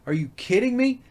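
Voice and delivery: outraged at yet another nudge; high incredulous outburst; exasperated disbelief. Retold or imitated. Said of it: "and I'm like".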